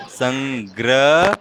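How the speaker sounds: संग्रह said very slowly, र stressed in ग्र; slow demo. Shown as a demonstration. Teacher stresses the र for the child, slow demo. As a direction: drag out संग्रह to show the र. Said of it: "ये है र".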